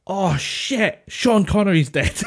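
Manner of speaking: high, startled cry with a laugh; shocked exclamation. Retold or imitated. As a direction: blurt out the news in shock. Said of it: "And she was, ding".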